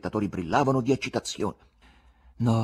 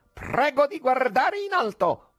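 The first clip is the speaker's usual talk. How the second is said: ordering; 14 semitones higher, slower than usual. A narrator's reading. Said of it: "e ordinò".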